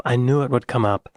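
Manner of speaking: ordinary talk, nothing marked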